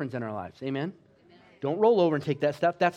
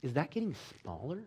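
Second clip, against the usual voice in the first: hushed aside; 10 dB quieter than usual. Retold or imitated. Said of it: "he leans over to the nurse, and he says".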